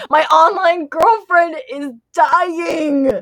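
slow, high, hammed-up crying shout; mock wail. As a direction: wail it in fake despair. Mock wail over game girlfriend.

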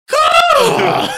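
very high squawking caw; shrill screeching bird. Retold or imitated. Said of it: "it's just birds screeching".

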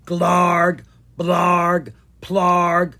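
loud, far-off beastly grunts; monster roars. Acted. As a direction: growl each like a stomping monster.

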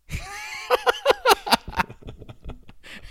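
big high laugh; loud burst of laughter. Real, not acted. Laughs after outing cohost's city.

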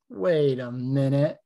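slow, drawn-out wait a minute as it clicks; slow realization. Retold or imitated. A grappler's brain catching on mid-roll, slowly realizing. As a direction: let the thought land slowly, puzzled. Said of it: "my brain goes".

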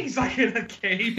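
usual speaking voice

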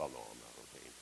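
a hushed, low admission of not being ordained; sheepish admission. Retold or imitated. Imagined pastor admits to a questioner he is not ordained, sheepishly soft.

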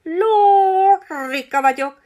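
high, loud, slow screeching parrot; grand parrot squawk. A narrator's reading. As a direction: clear your throat and screech proudly. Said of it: "köszörülni a torkát".

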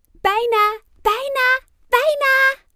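high, loud yell, climbing with each word; enthusiastic shriek. A narrator's reading. Ruby before the start of the race, enthusiastic shriek. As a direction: squeal it in mounting excitement. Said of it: "gilt Ruby enthousiast".

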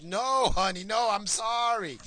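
high, loud imitation of a hospital worker backtracking; urgent high-pitched mock apology; alarmed apology. Hospital worker hastily corrects sobbing wife.

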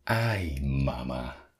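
very soft, sinking mock-fearful murmur; hushed dread. Child feeling a presence on his bed, hushed dread. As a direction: let it sink softly, as if scared in bed.

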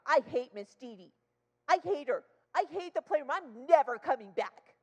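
loud mock kid's rant; imitated child tantrum. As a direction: rage like a little girl tattling to grandma. Child complains angrily to grandma.

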